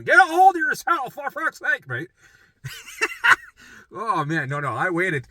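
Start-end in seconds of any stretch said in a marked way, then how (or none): 0.0-1.8 higher, louder mock stern dad; dad yelling at his son; dad scolding. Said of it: "My dad would have been". 2.6-3.4 high laugh. Real, not acted. Laugh after dad impression.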